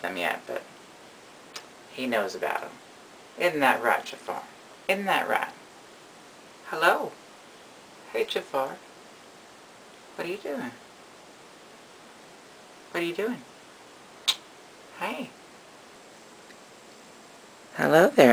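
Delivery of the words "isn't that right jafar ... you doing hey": higher, soft cooing at a pet. Real, not acted.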